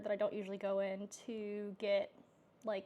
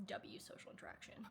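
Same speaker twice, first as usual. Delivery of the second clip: hushed voiceless aside; breathy voiceless whisper of W social interaction; 3 semitones lower, 11 dB quieter than usual. Real, not acted. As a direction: whisper it to yourself smugly.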